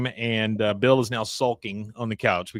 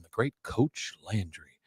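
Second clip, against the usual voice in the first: mildly awed name-drop; reverent; 2 semitones lower, 6 dB quieter, slower than usual. Retold or imitated. Hank praises his hero's coach, mildly reverent. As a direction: give the name a touch of grand respect. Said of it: "he says it with such air".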